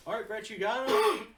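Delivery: loud, high call to a teammate. Retold or imitated. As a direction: shout it across the fight. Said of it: "I'm like".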